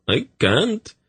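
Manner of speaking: a terrified fat eunuch refusing; frightened eunuch. Acted.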